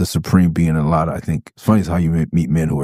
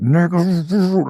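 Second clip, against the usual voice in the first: higher, tense, teeth gritted; clenched-teeth voice; 12 semitones higher than usual. Retold or imitated. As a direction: hiss it through clenched teeth. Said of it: "when you're talking like that".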